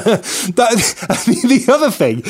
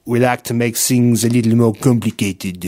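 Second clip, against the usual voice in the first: lower, put-on French accent; 6 semitones lower than usual. Shown as a demonstration. Host redoes the line for his co-host in a mock French accent. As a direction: say it like a Frenchman would, tongue in cheek. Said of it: "let me do the French one".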